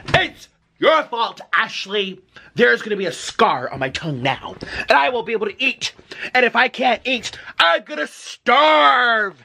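usual speaking voice

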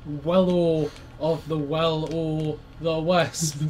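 very slow, drawn-out wordplay; slow teasing. Real, not acted. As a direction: stretch each word mockingly. Slow mock name.

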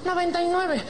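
very high, camp reply; mock-effeminate patient. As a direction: repeat it obediently in a mincing high voice. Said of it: "llega un putito".

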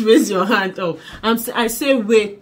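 ordinary talk

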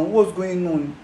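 usual speaking voice

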